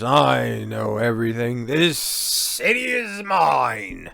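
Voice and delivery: put-on slow drunk English boast; drunk declaration. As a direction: proclaim it like a drunk king. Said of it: "He then declares".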